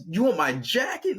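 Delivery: hammed-up shocked question; acted disbelief. Chris's jaw just drops.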